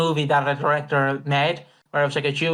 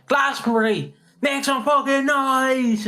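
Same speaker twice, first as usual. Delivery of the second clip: compere crowd call; 8 semitones higher, slower than usual. Retold or imitated. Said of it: "that one Frankie Boyle bit".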